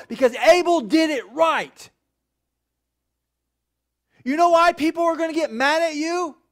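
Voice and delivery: rising to a shout about Cain; full-force preaching. Acted. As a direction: preach it hard to the room.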